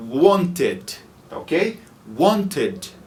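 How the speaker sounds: "Wanted" in two syllables, want-ed; full ed. Shown as a demonstration. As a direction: say the ed in "Wanted" clearly. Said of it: "aqui eu realmente vou pronunciar o E D".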